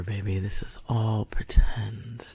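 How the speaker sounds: breathy whispered taunt; seductive whisper. Acted. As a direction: whisper it close and teasing. Slow whisper.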